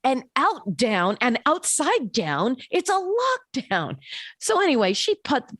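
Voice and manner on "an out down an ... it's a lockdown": put-on much higher, exasperated kid voice; outraged little kid. Kid telling his mom to stay inside, high exasperated voice. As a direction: play a fed-up boy lecturing his mom. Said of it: "He goes".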